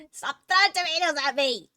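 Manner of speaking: high, loud mock outrage; playful yell. Real, not acted. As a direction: shout it at chat, half laughing. Reacting to chat throwing tomatoes.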